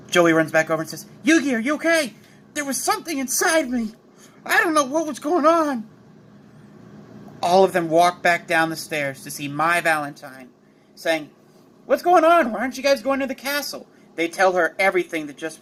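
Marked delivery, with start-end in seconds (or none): 1.2-2.1 high-pitched worried check; urgent, high, concerned question; alarmed concern. Acted. Joey runs back to upset Yugi. 2.5-5.9 high-pitched, upset Yugi voice; bewildered and high, close to a wail; distressed confusion. Acted. Yugi upset after losing control. 11.8-13.9 high-pitched puzzled greeting; surprised questioning. Acted. Mai questions the group in surprise.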